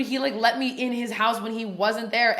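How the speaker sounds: usual speaking voice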